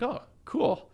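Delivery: high, sing-song mock "oh cool"; mock cheerful. Telling a podcast host about debt, a high mock-breezy "oh cool". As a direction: chirp it, high and airy, like nothing's wrong. Mock reaction to vanished card.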